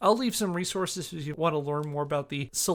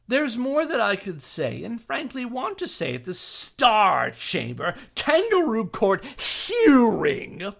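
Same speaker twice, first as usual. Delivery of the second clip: louder, higher declamatory reading; indignant formal statement; 6 semitones higher, 6 dB louder than usual. Acted. Reading Jack's statement at the referee, indignant and loud. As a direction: read it like a furious legal brief.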